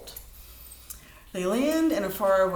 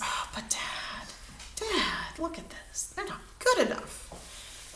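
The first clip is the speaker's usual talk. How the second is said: a breathy whispered aside to Dad, mostly soft; whispered plea; 9 semitones higher, 7 dB quieter, slower than usual. Acted. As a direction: whisper it to Dad as if sharing a secret. Daughter pleading secretly with father.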